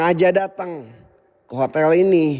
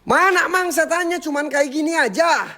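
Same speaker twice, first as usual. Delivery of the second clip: mocking taunt; 8 semitones higher than usual. Real, not acted. Mocking the weak haunting.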